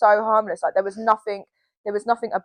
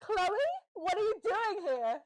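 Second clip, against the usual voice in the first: imitating the drag queen; 8 semitones higher, 16 dB quieter, slower than usual. Drag queen voiced in story.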